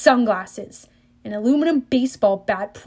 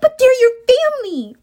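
much higher, louder shocked protest; 13 semitones higher, 7 dB louder than usual. A narrator's reading. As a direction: cry out against the cruelty. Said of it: "Annabeth protested".

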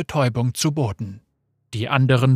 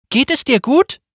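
worried call; 12 semitones higher, 6 dB louder than usual. Acted. Riven shakes fallen Muramat.

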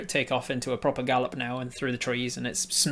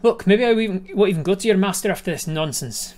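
higher, louder irritated threat; frustrated sprite; 6 semitones higher, 8 dB louder than usual. Acted. Frustrated sprite threatens to abandon the errand, louder. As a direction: huff it out, fed up.

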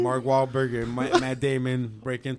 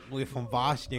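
put-on Boston accent. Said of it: "And they talk fucking weird".

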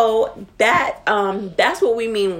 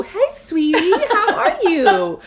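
higher, slow, sugary mock greeting; mock sweet voice; 10 semitones higher, 3 dB louder than usual. Friend mimics mom's switch from cursing to sweetness. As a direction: mimic a friend's fake-nice switch, laughing. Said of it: "I'm like".

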